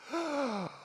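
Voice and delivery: sharp high gasp of wonder; delighted gasp. Retold or imitated. Awestruck gasp on walking into the French Impressionist gallery. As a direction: catch your breath in awe.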